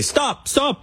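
high, lightly mimicked plea from an Irish bystander; a quick high "stop it", barely an Irish accent; a loose imitation of a bystander begging; pleading Irish bystander. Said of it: "I can't do an Irish accent".

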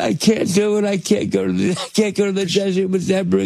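high-pitched, half-hearted whine; mock tearful protest. Retelling refusing the desert with Deborah, mock tearful protest. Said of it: "I think I started crying".

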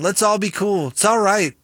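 normal talking voice

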